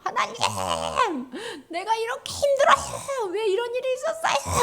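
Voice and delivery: mock sobbing prayer, high-pitched wail; 울부짖는 기도. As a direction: cry out to God in hammed-up tears. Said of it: "하고 막 울었겠지". (엉엉 우는 척 높게 울부짖으며)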